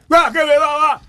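high, loud mocking shout of a rude customer; mock rude yelling.

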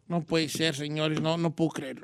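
dejected host sighing over the defeat; mock heartbroken. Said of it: "lo ve más agüitado".

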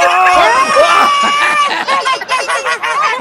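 big shouted burst of laughter; loud laugh. Real, not acted. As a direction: roar with laughter. Teacher bursts out at joke.

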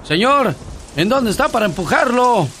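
high annoyed calling out; angry shout. Acted. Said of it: "todo enojado ... grita".

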